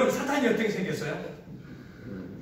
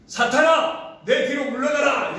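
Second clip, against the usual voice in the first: higher, louder commanding rebuke; 높고 크게 꾸짖듯; stern rebuke; 6 semitones higher, 4 dB louder, slower than usual. Retold or imitated. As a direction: cast him back with authority. Jesus rebukes Peter.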